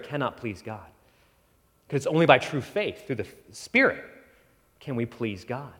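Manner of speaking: ordinary talk, nothing marked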